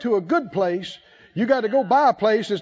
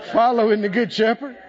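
ordinary talk, nothing marked

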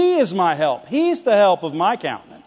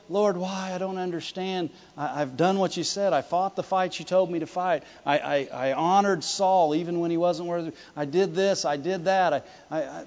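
soft, stammering self-justification; hushed, rambling grievance; mock complaining prayer; 2 semitones lower, 7 dB quieter than usual. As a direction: imitate pleading your case to God, quietly. Imitating a complaining prayer David didn't pray.

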